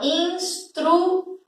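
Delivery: "Instruir" as three high, stretched beats, ins, tru, i; split into syllables. Shown as a demonstration. Teacher counting three syllables, each drawn out. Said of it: "Quando eu separo, eu tenho três sílabas".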